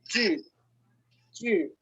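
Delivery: "chi" short and high, demonstrated for learners; pronunciación modelo. Shown as a demonstration. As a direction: model "chi" as an isolated Chinese syllable, pitched high. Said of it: "que se pronuncia". ("chi" agudo, como sílaba china de muestra)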